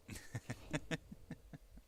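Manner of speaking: soft, breathy little laugh; light chuckle. Real, not acted. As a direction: chuckle quietly to yourself. Light laugh while playing.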